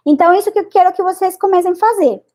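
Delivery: ordinary talk, nothing marked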